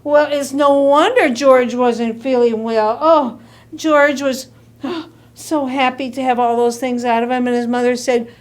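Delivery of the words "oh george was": ordinary delivery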